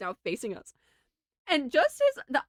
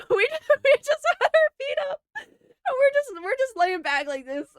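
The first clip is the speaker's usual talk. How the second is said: high, breaking into giggles; can barely get the words out for laughing; real giggly voice cracking up; laughing while talking; 13 semitones higher than usual. Real, not acted. Laughing while telling funny story.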